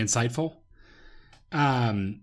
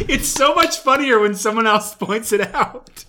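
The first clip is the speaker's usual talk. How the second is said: laughing; 7 semitones higher, 4 dB louder than usual. Real, not acted. Laughing about verbal ass.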